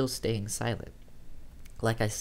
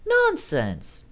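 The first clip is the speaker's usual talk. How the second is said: Amy's voice; 5 dB louder than usual. Acted. Narrator voices Amy on phone.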